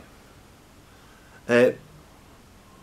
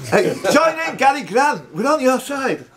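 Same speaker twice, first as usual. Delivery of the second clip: high, playful shout-out; excited call-out; 10 semitones higher than usual. Real, not acted. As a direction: call him over with a pitched-up cheer. Host calls out to Gary Grant.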